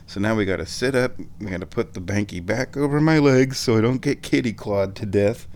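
ordinary delivery

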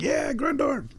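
high-pitched mock cheer; happy fan cheer. Host recalling factory visits as a happy fan, high cheer. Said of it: "I was always really happy to be there".

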